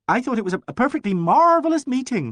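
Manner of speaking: high and loud, overly bright praise; a loud show of enthusiasm for listeners; loud gushing. A narrator's reading. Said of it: "she said loudly".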